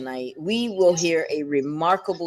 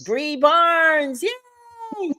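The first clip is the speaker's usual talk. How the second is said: excited cheer; 11 semitones higher, much slower than usual. Real, not acted. Host cheers guest's entrance.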